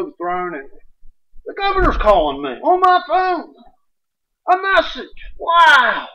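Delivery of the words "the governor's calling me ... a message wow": amazed. Real, not acted. Amazed at governor's call.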